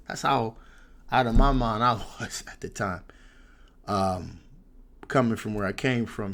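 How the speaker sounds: plain reading voice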